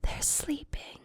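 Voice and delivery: tender hushed warning; whisper. Acted. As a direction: whisper so the sleeper stays asleep. Human asleep nearby.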